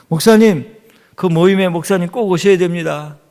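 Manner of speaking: high, loud, insistent phone voice; imitating someone pressing an invitation; urging caller.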